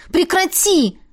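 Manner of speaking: high, alarmed cry; exclaiming. A narrator's reading. Woman stopping him trampling the jacket, exclaiming. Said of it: "воскликнула она".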